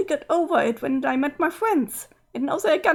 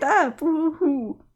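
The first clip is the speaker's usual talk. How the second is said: slow mock boo-hoo; much slower than usual. Sprite feels misunderstood.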